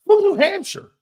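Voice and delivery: loud, high-pitched disbelief; mock incredulous. Host calling out coach's phony accent, loud mock disbelief. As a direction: blurt it high in mock outrage. Mocking coach's claimed Cajun roots.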